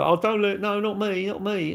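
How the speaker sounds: high, whiny mock plea of kids caught out; mocking begging kids. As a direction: squeak out a fake "not me" like a child hiding. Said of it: "Now they beg".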